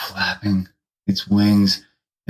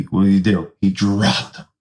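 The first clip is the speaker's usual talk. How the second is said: normal talking voice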